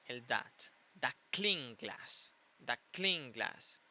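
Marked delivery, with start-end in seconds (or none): 1.0-2.3 "That" said as "dak" before "clean glass"; slow demo, "That clean glass" as "dak cling glass"; T becomes K. Shown as a demonstration. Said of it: "si sacamos la T a la K". 2.7-3.8 "That" said as "dak" before "clean glass"; slow demo, "That clean glass" as "dak cling glass"; T becomes K. Shown as a demonstration. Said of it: "si sacamos la T a la K".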